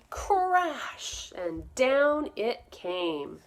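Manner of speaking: slow, animated picture-book reading; storybook voice for little listeners; reading to children. Acted. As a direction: read it slowly to a class of young kids. Teacher reads picture book aloud.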